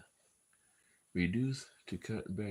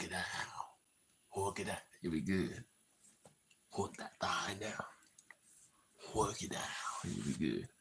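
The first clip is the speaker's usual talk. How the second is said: urgent whisper; 4 dB quieter than usual. Real, not acted. Coaching self to keep drink down.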